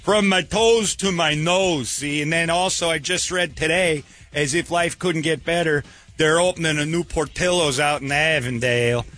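high-pitched, mock-lovesick Chicago accent. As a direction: gush like a lovestruck Chicago guy. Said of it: "he's got a Chicago accent".

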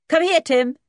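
high, loud sharp command; calling the dog. A narrator's reading. George calling Timmy off the boy, loud command. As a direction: call out briskly to bring him to heel.

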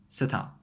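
"set out" as set-ou', no final T; T dropped. Shown as a demonstration. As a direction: say "set out" stopping short of the last T. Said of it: "Just get rid of the T".

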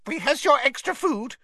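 high, piping elf squeak; squeaky house-elf. A narrator's reading. Elf hands Harry extra food, squeaking. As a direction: pipe it up as an eager servant. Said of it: "squeaked an elf".

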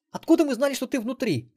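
high, loud snap back; snapping girl. A narrator's reading. Melissa snaps at Xen, high and loud. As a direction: bark the question at him. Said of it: "рявкнула Мелисса".

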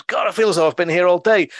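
high, loud mock complaint; exasperated bunny. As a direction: grumble as the bunny after a wasted day. Frustrated bunny leaving the office.

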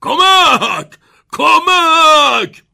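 high, loud drawn-out cries for help; panicked shout. A narrator's reading. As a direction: wail in alarm as if trapped. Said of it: "بابا بزرگ جو فریاد زد".

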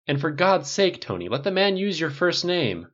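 fed-up friend pleading; an exasperated push. A narrator's reading. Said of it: "with clear exasperation".

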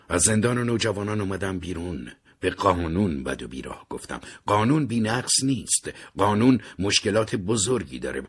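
plain reading voice

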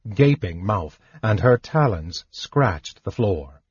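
ordinary delivery, nothing marked

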